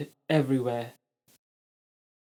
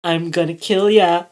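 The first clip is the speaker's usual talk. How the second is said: loud menacing cry; louder threat; 2 semitones higher, 8 dB louder than usual. A narrator's reading. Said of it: "Ella cried".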